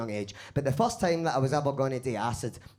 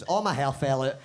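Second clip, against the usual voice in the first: ordinary talk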